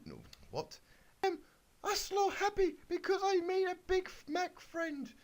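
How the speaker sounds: very high, sweet little pony voice; squeaky Twilight. Acted. Twilight answers the wizard in a very high, happy squeak. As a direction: chirp it like a toy pony.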